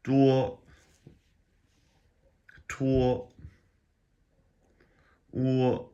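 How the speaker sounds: duo, tuo, wo held high and level; hollow-ended wo. Shown as a demonstration. Teacher showing hollowness at the end, high level tone. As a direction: let wo end hollow on a high flat pitch. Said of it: "it has that sort of that hollow".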